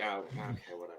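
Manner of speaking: very soft, deflated shrug; resigned. Retold or imitated. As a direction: let it go quietly. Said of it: "he was just like".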